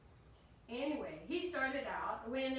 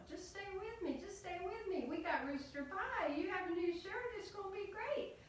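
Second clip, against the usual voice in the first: high, pleading mother voice; wheedling high-pitched persuasion; coaxing mama; 10 semitones higher than usual. Acted. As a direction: coax your child to stay. Mama begging Jack to stay.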